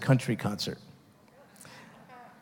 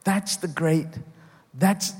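ordinary talk, nothing marked

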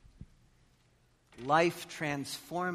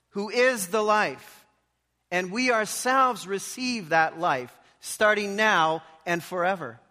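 high, loud, slow sermon climax; preaching at full force; 8 semitones higher, 11 dB louder than usual. Acted. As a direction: proclaim it slowly from the pulpit.

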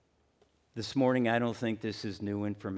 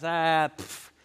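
dismissive scoff; 6 semitones higher, 3 dB louder than usual. Retold or imitated. Brushing off an apology.

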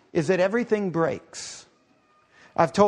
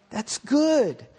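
delighted God; 6 semitones higher than usual. Retold or imitated. God admiring the sun and moon.

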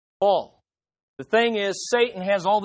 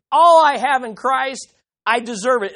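higher, louder smug entitlement; entitled voice; 5 semitones higher, 6 dB louder than usual. Pretend. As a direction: sound sure you earned it. Voicing an entitled believer.

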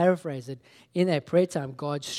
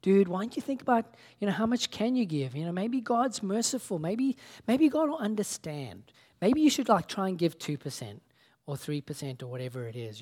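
a hint of a laid-back surfer, pitched up; lightly voiced surfer dude; 6 semitones higher, 4 dB louder than usual. To his brother on the phone, a light surfer-dude voice. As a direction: coax him like a mellow beach dude, half-playing it. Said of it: "a little being like a surfer".

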